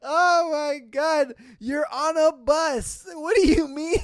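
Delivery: real laughing disbelief, voice way up; incredulous outburst. Real, not acted. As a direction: cry out in amused exasperation. Cracking up at the story.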